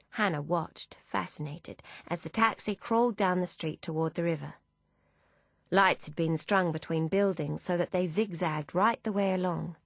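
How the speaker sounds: plain reading voice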